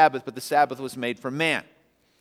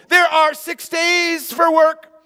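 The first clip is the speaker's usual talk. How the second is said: a stern official scolding the people; indignant rebuke; 13 semitones higher, 8 dB louder than usual. Acted. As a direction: synagogue leader berating the crowd for healing on the Sabbath. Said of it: "the synagogue leader said to the people".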